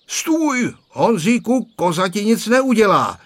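high, loud call across the yard. A narrator's reading. Grandpa calling from the gate to the scared boy, loud. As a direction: call out reassurance from a distance. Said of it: "zavolal od vrátek dědeček".

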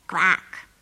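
low, loud quack; duck quack. Acted. Duck on the phone answering, quacking.

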